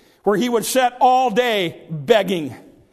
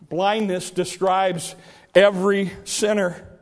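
ordinary talk

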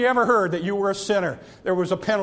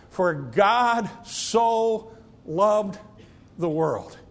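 slow reverent quote; much slower than usual. Acted. Repeats verse slowly.

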